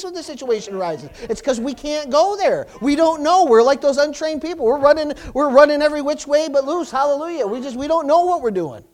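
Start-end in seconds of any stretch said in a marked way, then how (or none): 1.3-8.8 shouted hallelujah, high and loud; loud preaching exclamation. Real, not acted. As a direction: call it out to the church. Preaching at full force.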